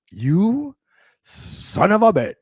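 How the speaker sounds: high, slow mock outrage; playful fake anger.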